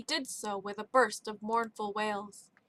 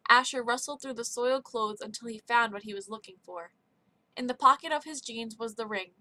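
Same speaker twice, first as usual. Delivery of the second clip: ordinary delivery, nothing marked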